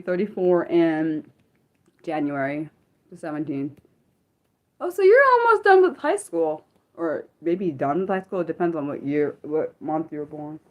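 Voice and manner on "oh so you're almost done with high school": surprised. Real, not acted. Reacting to a young viewer.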